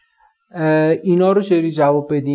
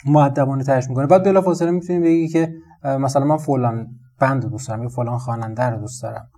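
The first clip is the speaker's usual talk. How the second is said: ordinary talk, nothing marked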